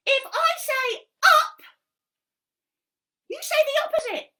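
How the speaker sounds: high, loud cheeky monkey voice; squeaky puppet monkey helping Helen. Acted. Monkey puppet explaining a game to Helen, high and bouncy.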